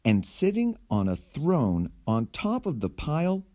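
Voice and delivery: usual speaking voice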